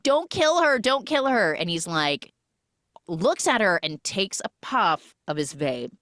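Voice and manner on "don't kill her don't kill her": retold pleading. Acted plea for a life.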